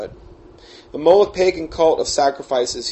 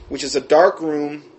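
ordinary talk

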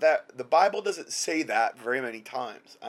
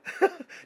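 happy high laugh. Real, not acted. Laughing at angel messenger.

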